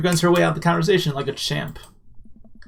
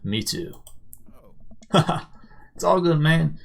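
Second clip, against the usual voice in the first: ordinary delivery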